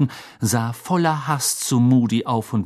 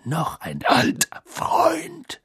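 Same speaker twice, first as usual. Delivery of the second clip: slow, growling character voice; gruff, drawn-out menace; growled; 11 semitones higher, much slower than usual. A narrator's reading. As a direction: growl it out, stretching every word. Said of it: "knurrte Moody".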